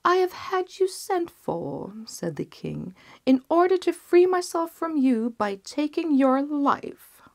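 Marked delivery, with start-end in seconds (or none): 0.1-1.9 much higher, imperious king; cold king. Acted. King addresses physician. 3.3-7.2 much higher, imperious king; cold king. Acted. King condemns physician.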